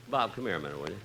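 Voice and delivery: ordinary talk, nothing marked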